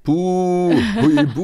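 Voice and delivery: high, loud spooky "Buh" stretched into "Huibu"; playful ghost boo. Pretend. Teasing the co-host with a high, loud cartoon-ghost boo.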